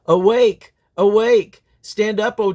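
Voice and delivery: loud, high call, each word hit hard; rousing cry. Acted. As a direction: shout it to wake a city. Prophetic call to awake.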